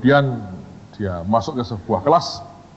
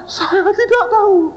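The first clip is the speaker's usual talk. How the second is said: very high, drawn-out wail; hammed-up crying student; mock tearful denial; slower than usual. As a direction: squeal it slowly in comic despair.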